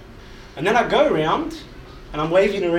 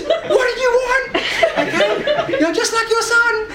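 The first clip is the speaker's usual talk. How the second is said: very high, loud mock scolding; hammed-up shrieking mum; mum cracking at dad; 6 dB louder, slower than usual. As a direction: screech at your husband as a fed-up wife. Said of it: "she cracks at him and she goes".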